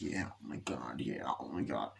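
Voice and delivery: very low and hushed; quiet unimpressed mumble. Retold or imitated. Viewer reacting to the nightclub scene, quiet unimpressed mumble. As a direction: drop right down under the breath.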